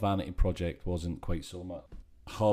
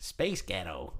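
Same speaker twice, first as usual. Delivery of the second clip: American accent; 2 semitones lower, 7 dB quieter, much slower than usual. Shown as a demonstration. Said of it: "in an American accent".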